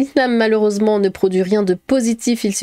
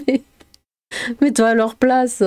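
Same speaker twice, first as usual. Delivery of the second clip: ordinary delivery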